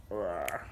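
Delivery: low mock growl; a little pretend monster roar; playful shark roar. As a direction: growl like a toy shark for fun. Playing with the toy shark.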